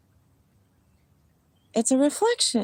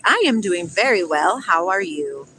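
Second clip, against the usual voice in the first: ordinary talk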